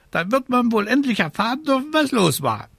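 high, rapid bird voice; impatient raven. Acted. Raven wanting news from the witch, impatient squawk. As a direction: rattle it off high and peevishly.

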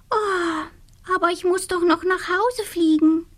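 high little witch voice, a mild protest; the witch fretting about flying home; worried little witch. Acted. (besorgte kleine Hexe, hohe Stimme)

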